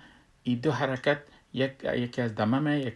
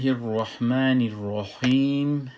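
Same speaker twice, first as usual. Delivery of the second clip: slow, drawn-out, louder Quranic recitation; recited basmala; 7 dB louder, slower than usual. Acted. Teacher opens the lesson with a slow, louder recited basmala. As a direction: intone the opening blessing slowly.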